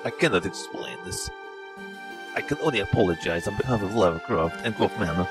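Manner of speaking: put-on broken English in a heavy Russian-style accent; bewildered Russian-accented gamer; mock Eastern European accent.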